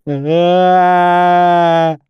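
loud, high drawn-out "aah"; long bleating cry of assent; wordless cry. A narrator's reading. High loud wordless cry. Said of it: "Tanny said".

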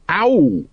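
high little yelp of pain; acted pained ow. A narrator's reading. Sergeant hurt by bandaging.

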